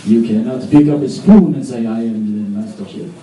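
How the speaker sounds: hammed-up French artiste defending his craft; slow, stretched French accent with grand pauses. Offended offender defends his trade as art, French accent. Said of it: "they all speak with a French accent".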